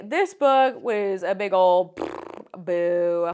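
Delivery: slow, stretched-out "big ol' boo"; mock booing. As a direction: drag it out like a disappointed groan. Pronouncing the book a letdown.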